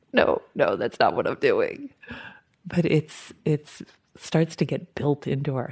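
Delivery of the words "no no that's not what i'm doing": low, imitated self-reproof; mock-catching yourself in the act, pitched low; retold self-correction. Practitioner catching his hand on the cell phone, low self-correction.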